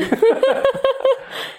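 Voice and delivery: 忍不住的高声笑; 被自己讲的故事逗乐，笑出声. Real, not acted. 讲述者被故事逗笑. (真心的高声大笑)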